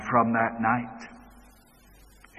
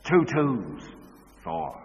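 curt, then loud and slow "Four and four"; a touch of the gruff father playing teacher; abrupt and unkind; 2 semitones higher, 5 dB louder, slower than usual. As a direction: snap the sums out unkindly. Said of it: "said very abruptly and unkindly".

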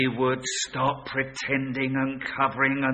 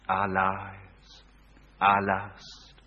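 normal talking voice

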